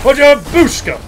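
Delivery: high, loud nonsense war cry; explosive gleeful exclamation; battle cry. Pretend. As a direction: belt it out with mock ferocity. Battle cry while smashing building.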